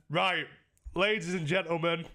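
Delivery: booming showman opener; host announcement. Real, not acted. Streamer calling his stream to attention, loud announcer voice.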